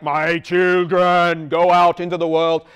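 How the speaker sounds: slow, booming godlike voice from above; divine voice. Retold or imitated. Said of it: "this divine sort of like".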